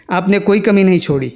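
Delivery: ordinary talk, nothing marked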